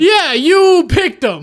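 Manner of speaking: high, loud, drawn-out blame; loud accusation. Real, not acted. Player blaming the lieutenant, loudly accusing. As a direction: throw it back at him, loud and slow. Blames teammate for picking him.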